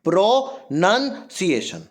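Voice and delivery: प्रोनंसिएशन slowly in pieces, "pro - nun - ciation"; broken-up प्रोनंसिएशन, no "ow" sound; correct pronunciation. Shown as a demonstration. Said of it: "प्रोनाउंसिएशन नहीं होगा".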